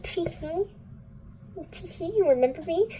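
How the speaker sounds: high, teasing put-on voice; hammed-up cutesy taunt; mock coy tee-hee. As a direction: play a giggly, coy tease in a high voice. Retold flirty giggly character.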